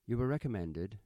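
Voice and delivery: a touch of prim correctness. A narrator's reading. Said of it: "she said primly".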